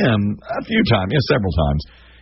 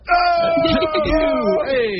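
high shouted hey with a laugh; fake-excited hey. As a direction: cover not knowing her with big cheer. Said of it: "I'm like".

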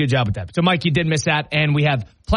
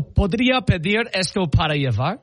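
Spanish read in a lightly put-on gringo accent; proudly showing off shaky Spanish; American-accented Spanish; 2 semitones higher than usual. Ordering food, showing off his American-accented Spanish. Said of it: "First of all, I got the accent going".

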